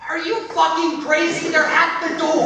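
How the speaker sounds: loud, slow, incredulous scolding; exasperated, urgent outburst; angry disbelief. Acted. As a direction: snap at a fool with trouble at the door. Berating colleague as police arrive.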